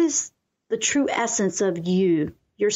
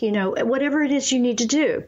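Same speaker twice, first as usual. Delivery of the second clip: usual speaking voice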